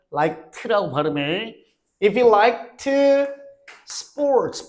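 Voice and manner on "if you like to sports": slow dictation. Shown as a demonstration. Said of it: "발음 틀려도 괜찮아".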